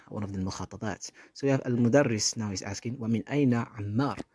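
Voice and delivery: usual speaking voice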